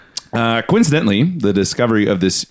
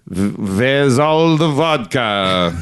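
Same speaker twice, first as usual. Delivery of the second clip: slow, hammed-up Russian accent; mock Russian drinker asking for vodka; slow comic Russian accent; much slower than usual. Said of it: "man, my Russian accent".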